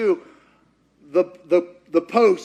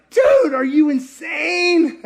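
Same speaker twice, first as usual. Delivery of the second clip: loud, slow, high outburst; incredulous shout; 8 semitones higher, 6 dB louder, slower than usual. Acted. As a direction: rebuke him in disbelief. Other thief rebukes the first.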